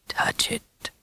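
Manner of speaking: low, breathy hushed whisper. A narrator's reading. Werewolf father whispering the boy's fate into his ear. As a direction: whisper it like a curse.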